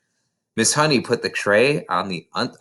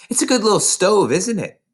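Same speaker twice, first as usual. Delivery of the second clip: high, louder, pleased little boast; bright proud remark; 10 semitones higher, 4 dB louder than usual. A narrator's reading. Miss Honey proudly shows Matilda her stove, high and pleased. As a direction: show off the humble stove proudly.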